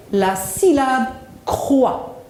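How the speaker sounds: very slow, "croix" set apart; model for repetition. Shown as a demonstration. Teacher ends the drill for pupils, "croix" slow and deliberate. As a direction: stretch "la syllabe", pause, then deliver "croix" clearly. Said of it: "Répétez après moi".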